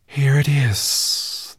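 high, very slow hissing sea serpent. A narrator's reading. Sea serpent hissing at the boy, slow and high. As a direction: draw it out like a giant serpent. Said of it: "the sea serpent hissed at the boy".

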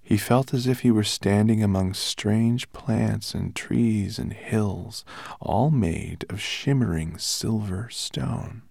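ordinary delivery

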